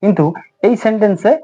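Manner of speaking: normal talking voice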